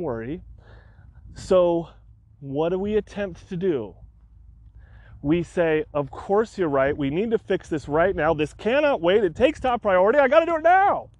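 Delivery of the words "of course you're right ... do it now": from urgent insistence up to a high, loud screamed Fix it; building to a scream. Retold or imitated. Owner retelling his panic at the web host, building to a scream. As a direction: let the panic climb until you scream the last words. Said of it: "I'm screaming at everybody".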